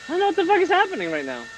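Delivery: high, fast, exasperated confusion; baffled outburst. Real, not acted. Streamer baffled by a terrible movie, high fast outburst. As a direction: blurt it out in total bewilderment.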